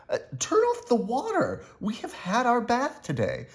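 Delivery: high, urgent complaint; crying out. A narrator's reading. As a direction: neighbours cry out to stop the flood.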